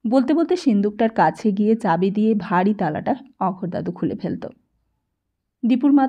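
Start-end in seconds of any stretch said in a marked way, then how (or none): none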